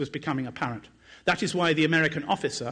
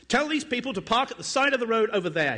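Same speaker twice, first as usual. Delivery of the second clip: pitched up, a half-voiced yell at the troops; narrated yell; 8 semitones higher than usual. A narrator's reading. Sergeant calling to his soldiers, a mild narrated yell. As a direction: call it out across the checkpoint, lightly. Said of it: "he yelled at the soldiers".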